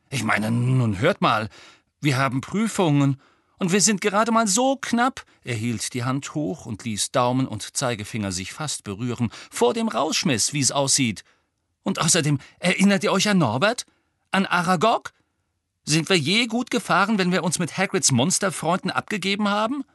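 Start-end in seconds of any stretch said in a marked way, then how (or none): 0.1-5.3 loud, worked-up pleading, climbing high; agitated argument. A narrator's reading. Ron urging friends about looming exams, agitated. 9.5-19.8 high, loud, indignant protest; agitated argument. A narrator's reading. Ron reminding friends of Hagrid's monsters, indignant.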